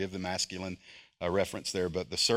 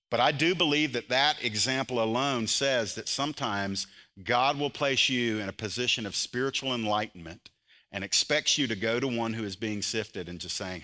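ordinary delivery

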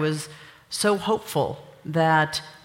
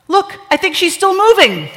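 high, loud punchline shout; amazed exclamation; 14 semitones higher, 10 dB louder than usual. Acted. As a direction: cry it out in mock astonishment.

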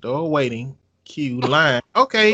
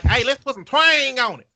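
Southern twang; 9 semitones higher, 5 dB louder than usual. Retold or imitated. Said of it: "the South was like".

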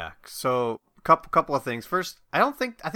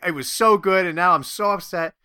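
mock upset; 5 semitones higher, 6 dB louder than usual. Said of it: "I was like".

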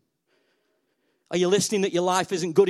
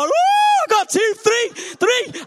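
high loud whoop; cheering as chairs turn; excited yell; 6 dB louder, slower than usual. Real, not acted. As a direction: shriek with joy at each chair. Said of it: "counting turned chairs".

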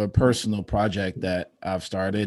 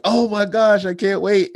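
much higher, louder retold excitement; imitating excited child; 12 semitones higher, 7 dB louder than usual. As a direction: gush like his thrilled son. Acts out son's excited reply.

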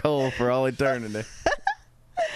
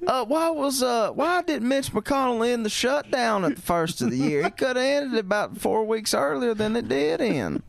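raised questioning voice; 8 semitones higher than usual. Retold or imitated. Imitating reporter asking about McConnell.

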